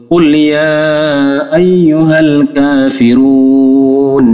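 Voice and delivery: slow, melodic tajwid recitation; Quran recitation. Shown as a demonstration. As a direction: chant the verse slowly like tilawah. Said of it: "recites Quran verse". (bacaan tartil yang lambat)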